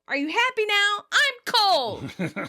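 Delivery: high, loud complaint; mock indignant outburst. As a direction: yell it at your tormentor, high-pitched. [name] snaps at the squirrel.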